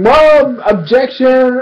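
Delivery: half-scream it like a frantic objection; stretched, high-pitched comic protest; mock courtroom scream. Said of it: "over here screaming".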